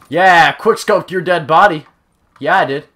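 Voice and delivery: loud, drawn-out victory cry; triumphant gloat. Real, not acted. Gloats over a kill.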